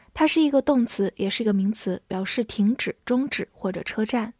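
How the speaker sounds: ordinary talk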